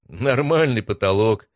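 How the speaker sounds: plain reading voice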